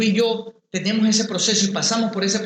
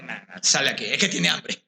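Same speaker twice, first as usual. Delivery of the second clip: calm wife voice; 9 semitones lower than usual. Retold or imitated. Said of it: "me dijo".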